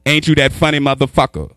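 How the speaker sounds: usual speaking voice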